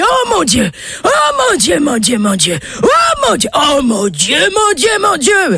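high wailing cries; hammed-up hysterical old man howling; howled lament. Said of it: "il se met à hurler".

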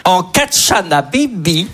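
slow, drawn-out classroom dictation; mock-solemn schoolteacher, stretching the syllables; teacher giving dictation. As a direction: read it out slowly for pupils to write down. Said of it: "vous imaginez les dictées".

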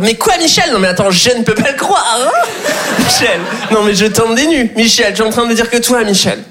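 hammed-up disbelief, talking down to a farmer; Karine Le Marchand. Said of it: "elle est genre".

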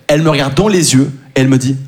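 usual speaking voice

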